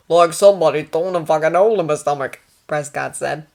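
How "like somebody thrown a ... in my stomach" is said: blunt Australian patient, loud; wounded Australian, swearing forcefully; Australian accent. Acted. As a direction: complain loudly in an Australian accent. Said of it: "Australian, that was what the accent".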